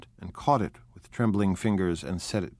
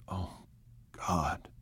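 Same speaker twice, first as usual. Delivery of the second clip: breathed out very softly, in misery; a hushed, despairing "Oh, God"; miserable whisper; 2 semitones lower, 9 dB quieter than usual. A narrator's reading. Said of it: "whispered ... in a miserable way".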